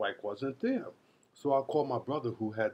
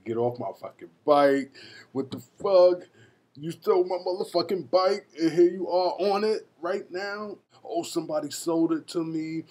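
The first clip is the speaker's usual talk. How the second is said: louder, higher yelling at a thief; retold angry shouting; furious confrontation; 6 semitones higher, 4 dB louder than usual. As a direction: rage at the guy on your stolen bike. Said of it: "I'm like".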